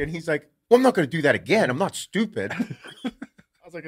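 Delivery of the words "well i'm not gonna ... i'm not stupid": fast, higher, louder retort; imitating an offended dad; indignant. Dad's indignant comeback retold.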